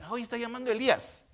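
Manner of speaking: lightly voiced bystander, quick and high; onlookers' remark. Onlookers at the cross remarking on the cry, quick and high. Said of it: "Entonces ellos dicen".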